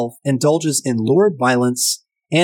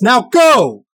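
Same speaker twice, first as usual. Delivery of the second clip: sudden shouted order, high and loud; mock shout; 8 semitones higher, 6 dB louder than usual. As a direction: bark the command like an angry cop. Retelling cop firing round.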